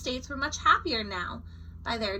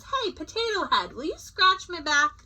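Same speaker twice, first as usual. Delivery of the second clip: cheeky call; 7 semitones higher than usual. A narrator's reading. Colorado calling to Idaho.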